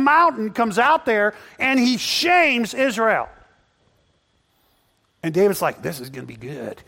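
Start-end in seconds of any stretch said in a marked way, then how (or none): 5.8-6.8 quiet, lower, relishing tone; low eager aside. Retold or imitated. David quietly relishing the coming fight. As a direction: murmur it with anticipation.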